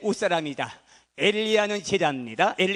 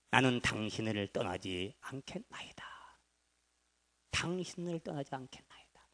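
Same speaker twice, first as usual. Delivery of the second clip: whispered, low and soft; hushed vow; 7 semitones lower, 12 dB quieter than usual. Acted. Elisha whispering his vow never to leave Elijah. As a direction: breathe the vow like a secret oath.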